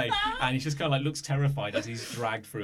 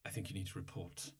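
very soft, low, halting summons; quietly and ominously send him off, with pauses; hushed, low character voice; odd official's voice; 5 semitones lower, 12 dB quieter than usual. Acted. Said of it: "what's up with my voice".

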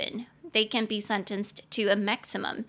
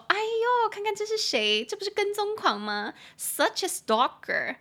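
joking tease; 5 semitones higher than usual. Pretend. Joking with a coworker who keeps turning up, teasing. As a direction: needle them with mock surprise. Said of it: "直接开玩笑说".

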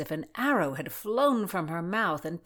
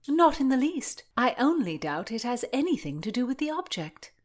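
higher, prim Constance; sceptical young gentlewoman; 7 semitones higher than usual. A narrator's reading. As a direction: coolly contradict the lord in a raised pitch. Voices Constance doubting Rutland.

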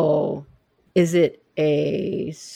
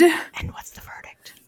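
high, slow whisper; suspenseful whisper; 8 semitones higher, slower than usual. Real, not acted. Co-host awaiting the app's answer, suspenseful whisper. Suspense before the owl is revealed.

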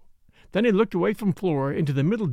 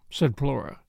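ordinary delivery

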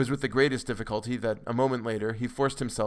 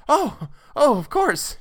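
high, loud panicked cry; a terrified nurse losing control; hysterical; 8 dB louder, slower than usual. A narrator's reading. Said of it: "she cried hysterically".

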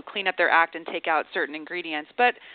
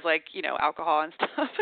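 normal talking voice

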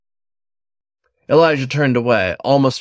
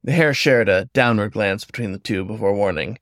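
plain reading voice

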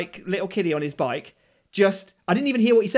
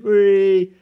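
loud, drawn-out whee; mock taunting whee; 10 dB louder than usual. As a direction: imitate a gleeful taunting squeal. Imitating bullies picking on him.

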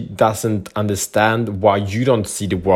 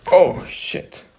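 a sudden breathless curse as a cat lands on him; real startled outburst; 9 semitones higher than usual. Real, not acted. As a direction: caught off guard, nearly whispered. Cat jumps on speaker.

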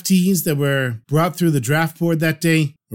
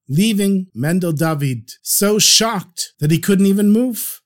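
plain reading voice

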